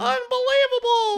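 very high, loud falsetto parody; Tana. Acted. Dad answers as his daughter, squealing imitation. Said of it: "Tana's voice was not exactly".

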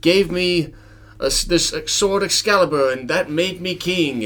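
high, loud, grand storytelling; King Arthur proclaiming. Acted. King Arthur explaining Excalibur to peasants, grand high proclamation. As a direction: recount it as a hammed-up king. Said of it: "And he's like".

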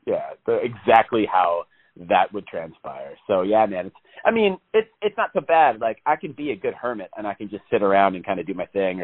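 ordinary delivery

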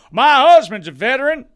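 mock quote. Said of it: "She's a".

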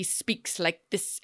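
choppy staccato demonstration. Shown as a demonstration. Explaining the actor's odd delivery to a co-host, staccato. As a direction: say it in separated little bursts. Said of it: "it sounds very staccatoed".

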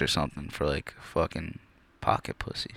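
unvoiced, sheepish whisper; whispered aside. Real, not acted. Admitting what he saved for, in an embarrassed whisper. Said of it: "embarrassed whisper".

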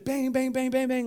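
rapid string of bangs with no gaps; run-together racket. Shown as a demonstration. Showing the congregation music without rests, run-together banging. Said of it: "if there is no rest, it runs together".

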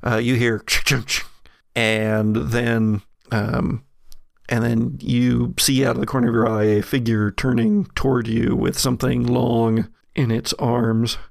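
ordinary talk, nothing marked

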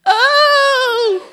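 high piercing cry of pain; child's cry. A narrator's reading. Boy Conrad crying out in pain, a high loud cry. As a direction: yelp like a child losing his thumbs. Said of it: "and Conrad cries out".

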